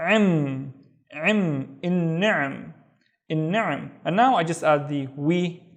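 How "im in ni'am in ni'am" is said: ordinary delivery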